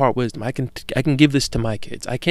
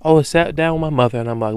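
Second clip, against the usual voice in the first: usual speaking voice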